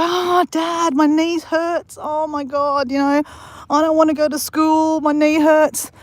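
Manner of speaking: put-on high-pitched whining child, dragging out my knee; whiny kid. Kid whining to dad about knee.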